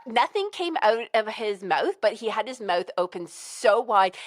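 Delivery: usual speaking voice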